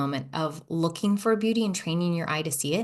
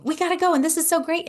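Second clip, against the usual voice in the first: much higher and louder, bursting with excitement; hyped-up energy; 10 semitones higher, 4 dB louder, faster than usual. Shown as a demonstration. Host demonstrating her hurried excited energy to listeners, high and loud. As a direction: show the rushed, buzzing energy. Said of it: "feel how much my energy just shifted".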